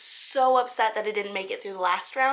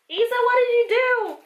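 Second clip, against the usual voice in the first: mock exasperated; 11 semitones higher, 7 dB louder than usual. Real, not acted. Fighting with the torn box.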